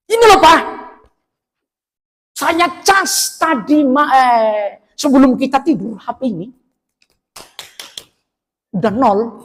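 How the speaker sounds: high, loud irritated complaining; complaining wife. Acted. Wife blaming husband for dead phone, high loud nagging. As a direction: nag him like an annoyed wife.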